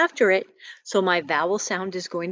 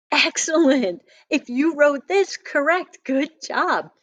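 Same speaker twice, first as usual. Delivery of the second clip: higher, eager praise; bright praise; 7 semitones higher than usual. Acted. Teacher praises children who wrote high correctly, higher and eager. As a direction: cheer them on for getting it right.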